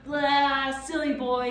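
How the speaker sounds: high, loud blah of mockery; taunting creature. A narrator's reading. Gaboon taunting Tom up close, mocking. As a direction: taunt him like a cheeky imp. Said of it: "sticking out its tongue".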